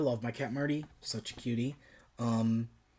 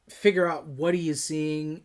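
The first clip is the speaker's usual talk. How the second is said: ordinary delivery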